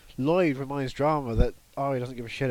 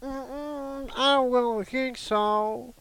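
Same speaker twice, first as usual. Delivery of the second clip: high, slow Southern drawl; sassy Southern woman, drawn out; Southern impression; 10 semitones higher, much slower than usual. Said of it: "That's my Southern impression".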